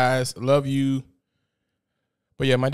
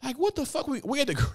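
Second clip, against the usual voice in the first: exasperated; 12 semitones higher, 4 dB quieter than usual. Real, not acted. Ranting at fighting parents.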